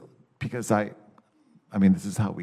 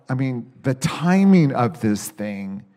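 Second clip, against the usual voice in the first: ordinary talk, nothing marked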